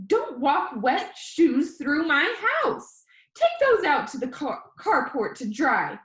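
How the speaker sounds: high, slow, stern grandma telling him off; grandma voice, high and loud, drawn out; scolding grandma. A narrator's reading. Grandma scolding over wet shoes.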